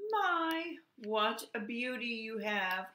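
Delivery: high, drawn-out "My", admiring; high-pitched singsong compliment; calling bluebird. A narrator's reading. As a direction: call across the river as a little bird. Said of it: "called a bluebird".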